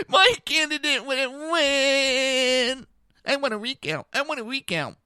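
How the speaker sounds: high, very slow whining voice; whiny mock crybaby. Mocking recount demanders as whiny children, high whine.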